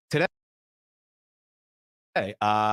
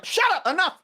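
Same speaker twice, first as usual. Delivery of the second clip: angry retort. Retold or imitated. Starving friend snapping at the broke gunman. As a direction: cut him off, fed up. Retold argument over food money.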